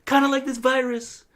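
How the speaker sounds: mock Trump boasting about toilets; altered-voice Trump; Trump impression. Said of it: "my Trump impression".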